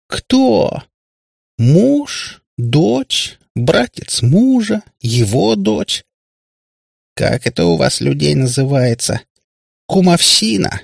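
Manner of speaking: very high, drawn-out mock questioning; издевательское удивление. A narrator's reading. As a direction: list them in exaggerated disbelief. Kutiyana mocks the proposed witnesses. (издевательски, высоко и нараспев)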